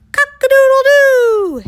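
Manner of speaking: very high, loud crow with a long held end; screamed rooster crow. Acted. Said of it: "he screamed".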